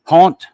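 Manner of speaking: haunt said with an open "ah"; rhyme example. Shown as a demonstration. Giving a rhyme for the French syllable, demonstrated "haunt". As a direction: stress "haunt" loudly as a sound example. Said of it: "haunt, like that".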